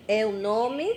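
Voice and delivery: ordinary talk, nothing marked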